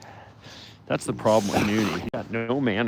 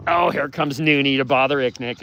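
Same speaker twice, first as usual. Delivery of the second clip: usual speaking voice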